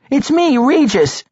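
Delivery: high, urgent plea; desperate cry. A narrator's reading. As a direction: cry out who you are before he strikes. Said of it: "he cried".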